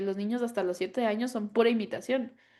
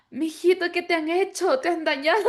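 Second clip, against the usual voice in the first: high, loud mock dismay; shocked grandfather; 8 semitones higher, 6 dB louder than usual. Grandfather asks the grandson what they've done to him, in high, loud mock alarm. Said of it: "mi papá le dice así como".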